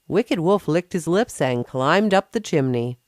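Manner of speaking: ordinary delivery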